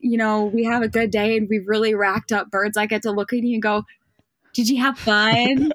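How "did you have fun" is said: slow, higher mock-sweet question; sweet taunt. Winner looking at beaten rivals, mock-sweet taunt. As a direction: stretch it out to needle them.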